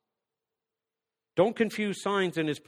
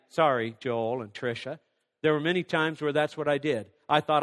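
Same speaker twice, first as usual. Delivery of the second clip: usual speaking voice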